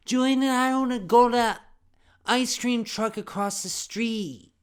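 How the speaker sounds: put-on high, loud little-kid yell; slow, shrieky kid demand; intensely enunciating kid. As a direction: hammer out each word like an insistent child. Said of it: "enunciated words really intensely when I was a little kid".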